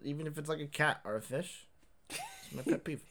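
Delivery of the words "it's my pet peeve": high, soft goofy punchline; high silly voice. Pretend. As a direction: squeak out the punchline.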